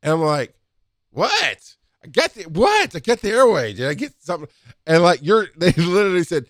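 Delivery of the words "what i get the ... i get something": confused what. Retold or imitated. Everyone stares at him.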